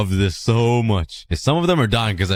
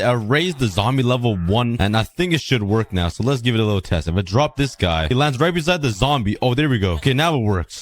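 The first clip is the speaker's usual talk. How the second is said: ordinary talk, nothing marked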